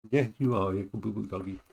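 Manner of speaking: ordinary delivery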